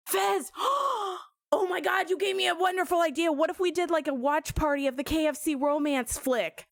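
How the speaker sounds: high, fast, thrilled gasp of an idea; sudden excitement. Real, not acted. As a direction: blurt it out, delighted.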